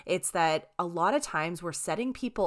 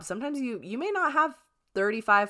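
ordinary talk, nothing marked

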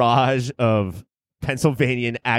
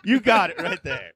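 mock reply; 5 semitones higher than usual. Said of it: "And I was like".

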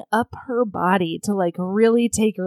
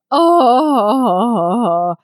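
long wailing "oh"; drawn-out sympathetic moan; pained groan; 5 dB louder than usual. Real, not acted. Host reacts to heroine hiding pain, long pained groan.

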